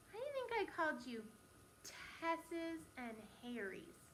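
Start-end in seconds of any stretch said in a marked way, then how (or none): 1.8-4.0 very slow, softer, stretched sounds; drawn-out hint. Shown as a demonstration. Teacher prompting the class to guess, slow and drawn out. Repeats nickname slowly as hint.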